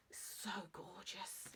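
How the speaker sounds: slow breathy whisper of awe. Real, not acted. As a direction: hush it in wonder. Admiring cover, whispered.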